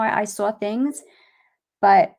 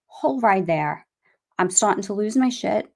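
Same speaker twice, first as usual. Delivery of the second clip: ordinary talk, nothing marked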